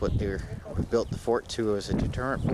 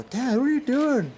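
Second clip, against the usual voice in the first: mimicking his son; 6 dB louder, slower than usual. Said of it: "Jeremy's gonna look at this and go".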